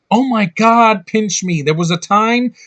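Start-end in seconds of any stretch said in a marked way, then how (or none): 0.1-1.6 loud, high sarcastic disbelief; hammed-up astonished outburst; mock amazement. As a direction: exclaim as if you can't believe your luck. Mock amazement at male leads.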